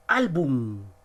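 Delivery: Álbum with a punched "Ál"; stress on penultimate syllable. Shown as a demonstration. Teacher giving a grave example, Álbum stressed on "Ál". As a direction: hit the first syllable of Álbum. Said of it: "la mayor fuerza de voz".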